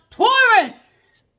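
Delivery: high loud drawn-out call of the name. Real, not acted. Tarot reader calls out Taurus, loud and high. Calling out the sign.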